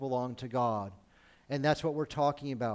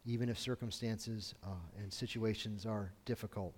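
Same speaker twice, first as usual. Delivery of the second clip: soft, hushed earnest prayer; 5 semitones lower, 7 dB quieter than usual. Real, not acted. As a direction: pray quietly and sincerely. Pastor prays before the message.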